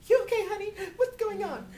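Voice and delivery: mock high-pitched, loud worried relative; fussing worried relative. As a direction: play the doting aunt fussing over him, high and loud. Worried family on Facebook, imitated.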